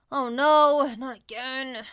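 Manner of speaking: loud dismayed cry; loud, stricken groan. Acted. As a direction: wail it as the lunch drops. Dropped lunch on loafers.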